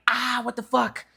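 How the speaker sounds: high-pitched startled yelp of protest; imitated pained protest. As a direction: react as if suddenly pinched. Acting out reaction to being pinched.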